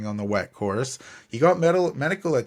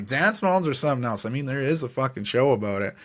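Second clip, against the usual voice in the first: usual speaking voice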